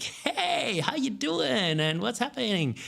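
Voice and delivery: very high, drawn-out upbeat greeting; energetic voice message. Shown as a demonstration. Coach showing how they open voice messages, energetic and high. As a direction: sing out an over-the-top, high-pitched hello. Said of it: "Instead of just sending a boring voice message".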